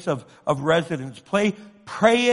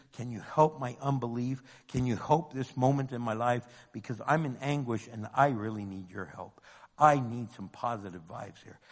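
low, soft, intimate plea; honest, doubting prayer; 8 semitones lower, 6 dB quieter than usual. Acted. Preacher models honestly questioning God in anguish, quiet pleading. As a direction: drop to a hushed, earnest prayer for help. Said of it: "Be honest when you do".

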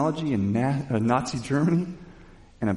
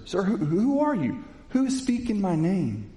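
a high, stammering plea; ask, stunned and unsure; a blinded Saul asking the voice who it is; bewildered question; 8 semitones higher than usual. Acted. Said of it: "That Lord part is more like a sir".

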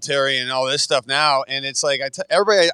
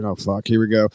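weary dread; 7 semitones lower than usual. Retold or imitated. Inner reaction to bragging.

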